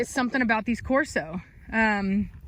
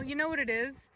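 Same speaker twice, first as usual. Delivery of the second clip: ordinary talk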